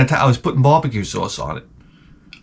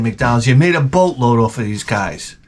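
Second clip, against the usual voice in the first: normal talking voice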